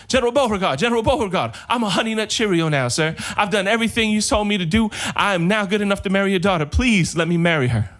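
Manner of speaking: excited suitor begging to marry; eager pleading. Acted. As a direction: beg the general, all excited. Joe runs up begging.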